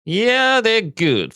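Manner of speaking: high, loud burst of enthusiasm; sudden delighted outburst; excited exclamation. Real, not acted. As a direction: shout it out like a fan cheering. Bursts out praising the stock.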